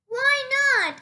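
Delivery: high, loud curious question; Frog asking. Acted. Frog questions Toad, high and loud.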